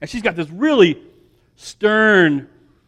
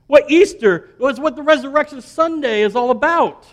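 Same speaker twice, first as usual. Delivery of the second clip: usual speaking voice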